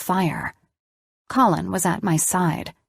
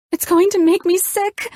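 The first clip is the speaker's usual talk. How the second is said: put-on high, loud whine of a five-year-old; whiny child voice; 12 semitones higher, 4 dB louder than usual. A narrator's reading. Elena to Colin about the chicken, childish high whine. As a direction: whine it like a little kid. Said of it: "stupid fucking whiny voice of a five-year-old".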